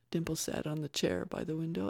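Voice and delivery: usual speaking voice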